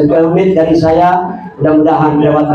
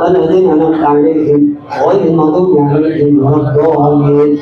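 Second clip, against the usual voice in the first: rapid, even chanted recitation; fast Quran recitation; 2 semitones lower, much faster than usual. Acted. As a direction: rattle through the Fatihah as a group prayer. Leads the Fatihah recitation.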